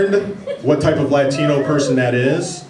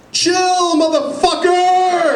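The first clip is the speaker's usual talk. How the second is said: much higher, slow exasperated snap; mom snapping at kid; much slower than usual. Retold or imitated. Mother at KFC telling off her pestering child, high and fed up. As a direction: stretch it out, fed up. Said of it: "the woman looks down and says".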